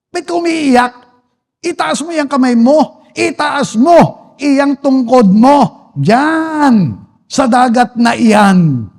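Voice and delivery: high, drawn-out ringing command; the Lord. Acted. Preacher voices God ordering Moses to raise his staff, commanding. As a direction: stretch each order out with rising force. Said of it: "Ano yung sabi ng Lord sa kanya?".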